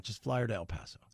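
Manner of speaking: conspiratorial stage whisper; mock whisper. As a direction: whisper it like a secret aside. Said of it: "And they're like".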